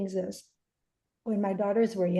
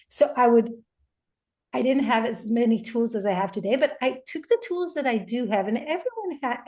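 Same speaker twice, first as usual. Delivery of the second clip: ordinary talk, nothing marked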